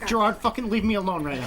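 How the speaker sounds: ordinary talk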